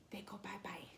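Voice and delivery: soft unvoiced whisper, half joking; conspiratorial whisper to the viewers; whispered aside. Real, not acted. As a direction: lean in and whisper it like a secret joke. Joking aside about family leaving.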